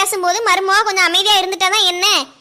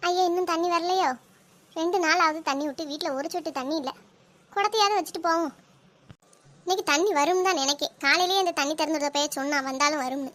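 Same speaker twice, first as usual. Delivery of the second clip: muttering to herself; 3 semitones lower, 7 dB quieter than usual. Acted. Woman mutters to herself at tap.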